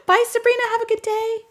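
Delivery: high cheerful goodbye call; high sing-song goodbye. Real, not acted. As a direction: call out a bright farewell. Calling goodbye to someone.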